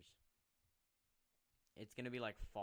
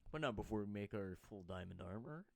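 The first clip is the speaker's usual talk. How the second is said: ordinary talk, nothing marked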